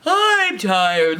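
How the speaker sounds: loud, drawn-out complaint; hammed-up weariness; tired Papa Bear. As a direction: groan theatrically about being worn out. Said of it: "Papa's like".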